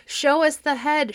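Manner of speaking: loud, high crowd demand; an angry mob yelling at Perseus; shouting crowd. A narrator's reading. Crowd shouting at Perseus for the head, loud. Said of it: "shouted the people".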